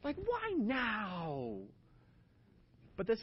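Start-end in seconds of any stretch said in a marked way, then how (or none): 0.0-1.6 slow, high, whiny exasperation; exasperated mock complaint. Husband complaining at his wife's body, mock exasperated. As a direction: drag it out like a fed-up husband. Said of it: "I'm like".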